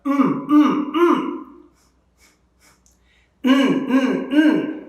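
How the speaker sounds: high, short hums on "mm", then on N; isolated M and N sounds. Shown as a demonstration. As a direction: hum each "mm" with a rise and fall. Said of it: "We'll do the M sound and then the N".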